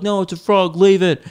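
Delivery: a follower defending the frog, lightly mocked; mock protective follower. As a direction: play the toad-saver, a bit over the top. Other followers objecting.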